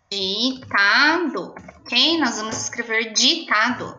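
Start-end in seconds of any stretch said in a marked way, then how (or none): 0.1-1.5 drawn-out dictation word; slow dictation. Shown as a demonstration. Teacher to pupils, the word drawn out slowly. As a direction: say it slowly for children to write. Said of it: "a gente vai escrever di".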